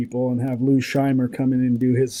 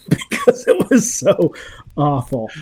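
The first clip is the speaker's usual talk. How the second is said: higher, laughing through the words; 3 semitones higher than usual. Real, not acted. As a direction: laugh as you say it. Laughing at how awful it was.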